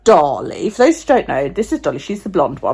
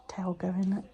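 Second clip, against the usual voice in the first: whisper; 16 dB quieter than usual. Real, not acted. Whispered aside.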